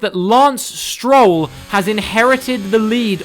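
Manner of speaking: sudden loud, high shout of surprise; excited commentary. Real, not acted. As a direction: call the crash at full volume. Crash caught live in commentary.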